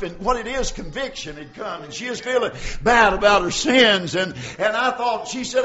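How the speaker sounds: normal talking voice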